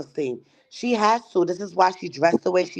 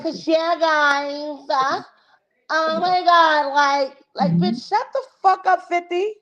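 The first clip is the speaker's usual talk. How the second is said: high, mocking valley-girl impression of the woman; mocking her voice; 9 semitones higher, slower than usual. Mocking the woman's annoying way of talking to her chat, pitched up. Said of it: "She be like".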